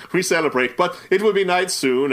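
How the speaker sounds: ordinary delivery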